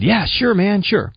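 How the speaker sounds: high, eager yes-man reply; breezy agreement. Retold or imitated. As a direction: agree lightly as your giddy younger self. Acting out own breezy agreement.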